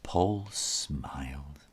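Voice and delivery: usual speaking voice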